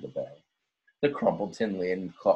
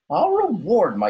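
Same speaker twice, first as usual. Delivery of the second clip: put-on old crab Kermit; 13 semitones higher, 7 dB louder than usual. A narrator's reading. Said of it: "said old Kermit".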